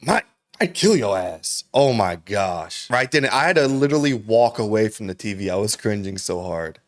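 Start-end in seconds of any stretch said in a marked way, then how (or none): 0.0-1.6 slow, mock-menacing trash talk; mock-tough cringy boast; cringy tough-guy threat. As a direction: ham up the awkward threat like a wannabe hard man. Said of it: "That shit was so cringy".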